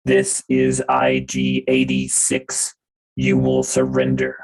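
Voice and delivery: low, slow, loud droid monotone; robotic voice. Acted. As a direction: boom it out like a battle droid. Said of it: "uh, robotic voice".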